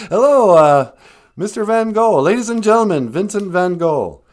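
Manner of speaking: hammed-up radio welcome; show host intro. Host greets caller grandly.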